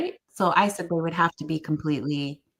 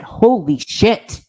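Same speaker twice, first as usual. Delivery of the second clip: slow, loud amazed exclamation; drawn out in disbelief; imitating stunned awe; 4 semitones higher, 10 dB louder, much slower than usual. Man stunned sitting up.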